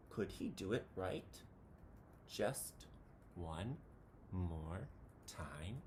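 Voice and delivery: soft, hushed and slow; hushed suspense. A narrator's reading. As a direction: drop to a tense hush. Nervous suspense.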